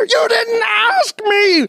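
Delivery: high, slow, whining cry; sob-yell like a hurt child; whiny wailing complaint. Acted. Acted quarrel, whiny complaint.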